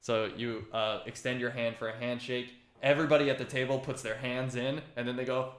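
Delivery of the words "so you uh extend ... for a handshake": ordinary delivery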